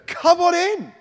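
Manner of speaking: light high sing-song "come on in"; mock easygoing invitation at the door; a hammed-up cheerful host; breezy welcome. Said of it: "You don't go".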